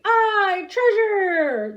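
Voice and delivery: high, loud cheer of pirates; crew shouting. A narrator's reading. Pirate crew shouts about the treasure, loud and high. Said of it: "the others shouted".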